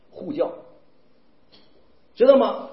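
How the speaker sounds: ordinary talk, nothing marked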